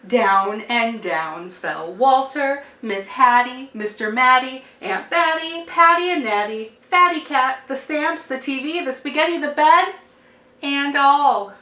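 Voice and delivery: rising cumulative chant; building refrain. A narrator's reading. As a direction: build the list to a big finish.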